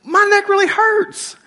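high, slow pitiful whine; milk the sympathy, slow and high; mock whiny complaint. Husband complaining to his wife, high slow mock whine. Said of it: "I said".

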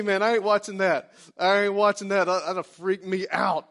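usual speaking voice

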